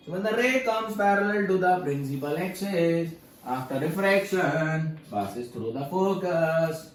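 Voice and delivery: very slow, drawn-out sing-song reading of the rule; sing-song recitation. Shown as a demonstration. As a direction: chant the rule slowly, almost singing. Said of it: "sir गाना मत गाओ".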